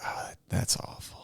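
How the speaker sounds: whispered imitation of a disgusted audience member; hushed heckle. Someone in the back of the club reacts to a joke, whispered. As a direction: mutter it softly from the back. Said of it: "someone in the back of the club being like".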